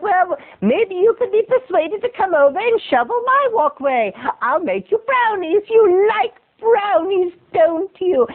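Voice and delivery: put-on high-pitched wheedling old woman; old lady voice. Old neighbor bribing boy with brownies, wheedling. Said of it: "That's my old voice".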